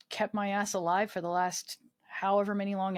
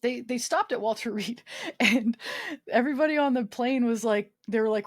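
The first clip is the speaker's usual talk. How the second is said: ordinary talk, nothing marked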